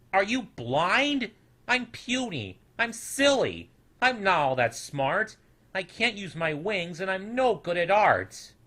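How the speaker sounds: put-on high, loud voice of an upset little boy; a small creature pouring out his worries, pitched high; upset little snoodle, high and loud. Small snoodle's hurt questions.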